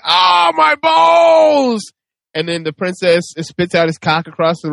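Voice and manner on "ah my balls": very high, stretched-out howl; hammed-up shriek of agony; Dante's pained scream. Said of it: "then Dante's like".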